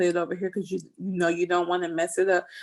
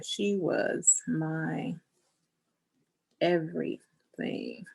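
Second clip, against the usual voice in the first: slow dictation; 4 dB quieter, much slower than usual. Real, not acted. Writing words about her late mom.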